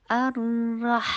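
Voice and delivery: الرح slow and loud, lingering on the r; held r sound. Shown as a demonstration. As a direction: dwell on the doubled r of الرح. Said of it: "تھوڑا سا time لگنا ہے".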